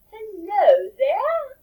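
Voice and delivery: high slow loud mermaid call. Acted. Mermaid greets the children again, high slow mermaid call. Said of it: "and she says".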